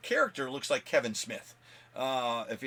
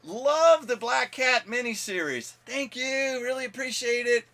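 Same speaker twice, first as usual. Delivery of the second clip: high, loud call from a car window; excited fan hollering praise from a car; 9 semitones higher, 5 dB louder, slower than usual. Retold or imitated. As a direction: shout up to a celebrity from the car. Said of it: "stick the head out and go".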